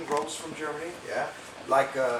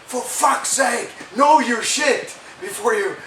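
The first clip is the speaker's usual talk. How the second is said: mock furious rant, higher, louder and slow; imitating a producer losing his temper, slow and loud; 7 semitones higher, 7 dB louder, slower than usual. Producer scolding the band for not knowing their parts, furious and loud. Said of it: "The producer was furious".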